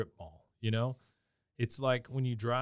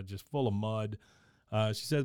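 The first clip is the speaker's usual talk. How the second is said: normal talking voice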